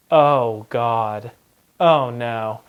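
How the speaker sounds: low, heavy mock dismay with pauses; sinking dismay. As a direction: let the bad news sink in, pitch dropped. Retold dismay at missing license.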